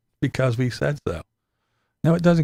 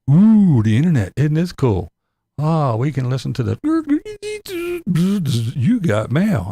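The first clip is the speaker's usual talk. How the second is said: mock wonder; 4 semitones higher, 4 dB louder than usual. Early internet user's wonder.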